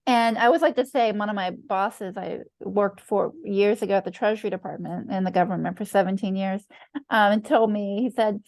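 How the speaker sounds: ordinary talk, nothing marked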